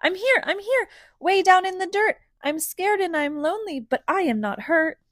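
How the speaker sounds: high little seed voice; small seed voice. A narrator's reading. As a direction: play a tiny scared seed. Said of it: "little seed's muffled voice".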